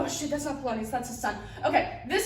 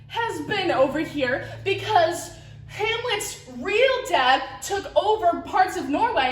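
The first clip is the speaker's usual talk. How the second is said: normal talking voice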